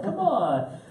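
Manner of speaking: high-pitched, teasing pagan cajoling monotheists; mocking Greek pagan. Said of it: "the Greeks want to force them".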